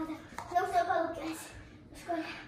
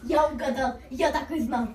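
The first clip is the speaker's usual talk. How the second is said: usual speaking voice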